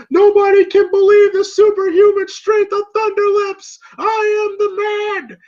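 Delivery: high, loud wrestler's boast; Thunderlips. Retold or imitated. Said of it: "in a gorilla press position going".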